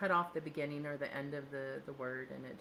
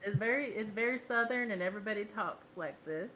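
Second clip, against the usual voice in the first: higher-pitched, exaggerated Southern twang; 5 semitones higher, 15 dB louder than usual. Shown as a demonstration. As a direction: demonstrate a thick Southern accent. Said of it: "talks like this with a Southern twang".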